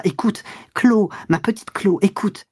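high, coaxing entreaty falling away; desperate pleading. A narrator's reading. Lover holding back his departing mistress, imploring. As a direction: beg her tenderly to listen.

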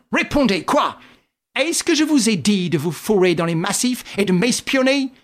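a furious friend scolding Wilson; angry. A narrator's reading. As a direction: shake him with angry questions. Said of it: "envahi d'une colère".

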